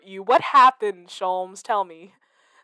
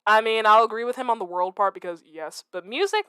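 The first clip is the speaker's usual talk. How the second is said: ordinary delivery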